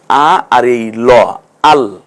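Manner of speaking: slow, separating আ আর এই ল; teacher spelling letters slowly; slow spelled-out syllables. Shown as a demonstration. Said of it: "একটু ভেঙে ভেঙে বলুন".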